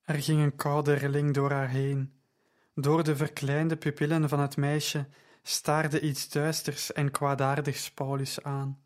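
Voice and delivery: plain reading voice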